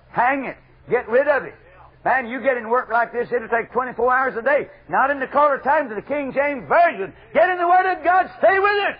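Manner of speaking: building to a high, loud pulpit cry; full-force preaching. Real, not acted. Preacher telling would-be home founders his standards, full-force preaching.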